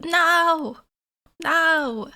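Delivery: usual speaking voice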